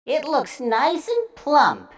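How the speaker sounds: a hint of a pleased mother; mother eyeing the toe for soup; lightly voiced mother. A narrator's reading. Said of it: "she said".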